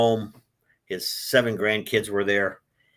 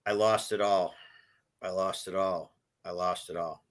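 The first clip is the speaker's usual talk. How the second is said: each repeat softer and lower; fading lament; 4 semitones lower, 6 dB quieter than usual. Retold or imitated. Retelling his words while sobbing on the plane, fading softly. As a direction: let it fade to almost nothing. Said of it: "the words that I was saying was".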